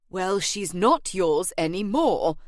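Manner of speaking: slow, emphatic correction; agent's put-on voice, fed up; exasperated. A narrator's reading. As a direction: spell it out in exasperation. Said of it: "the agent said in exasperation".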